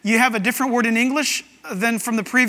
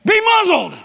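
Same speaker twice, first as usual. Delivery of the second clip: shouted command; 9 semitones higher, 6 dB louder than usual. Acted. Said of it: "Jesus shouted".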